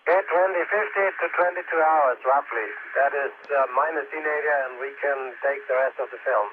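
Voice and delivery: distorted, overloaded radio; crackling, blown-out radio transmission; radio breaking up. Real, not acted. Distorted loud air-to-ground downlink.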